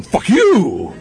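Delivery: hammed-up insult with a stretched "you"; mock infighting. Pirates turning on each other, hammed-up defiance. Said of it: "going to each other and going".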